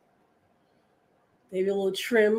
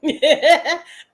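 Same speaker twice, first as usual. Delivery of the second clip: short loud laugh at herself; a quick real laugh; 6 semitones higher, 6 dB louder than usual. Real, not acted. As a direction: burst out laughing.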